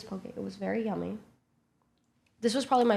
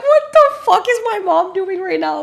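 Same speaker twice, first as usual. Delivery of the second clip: much higher, louder stunned outburst; shocked disbelief; 10 semitones higher, 8 dB louder than usual. Retold or imitated. Narrator reacting to her drunk mom, high loud disbelief. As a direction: blurt it in total disbelief.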